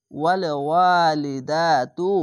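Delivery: slow, each vowel held out; drawn-out reading. Shown as a demonstration. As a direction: stretch the long vowel one alif length. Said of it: "জাবারের পাশে খালি আলিফ এক আলিফ টেনে পড়বেন". (এক আলিফ টেনে ধীরে পড়া)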